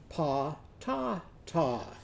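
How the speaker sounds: "Pa-ta-ta" slow and soft, as pah-tah-tah; "ah" in every syllable. Shown as a demonstration. Teacher renames Ollie's potato, "Pa-ta-ta" slow with open "ah". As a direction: separate "Pa-ta-ta" into three long "ah" beats. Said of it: "what is it?".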